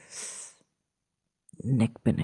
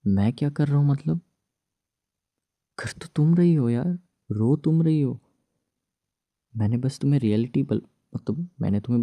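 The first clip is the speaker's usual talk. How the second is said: higher, exasperated retort; heated argument; 5 semitones higher, 4 dB louder than usual. Acted. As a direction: push back at her, fed up. Arguing with crying wife.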